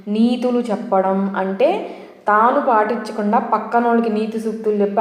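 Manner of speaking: ordinary delivery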